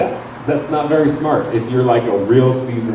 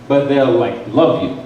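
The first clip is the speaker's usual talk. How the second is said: ordinary talk, nothing marked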